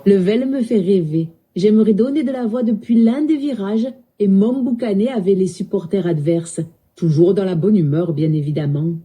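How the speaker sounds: Marseille accent, rising then dropping; southern French accent. Shown as a demonstration. Assistant dreaming of the stadium, southern French accent. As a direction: imitate a southern French football fan's accent. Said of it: "parle avec l'accent du sud".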